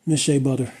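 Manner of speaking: hammed-up gushing ad read for a fellow YouTuber; mock sponsor ad read. Vlogger thanks a fellow creator in a mock sponsor read, gushing. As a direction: sell it like a cheesy fake sponsorship.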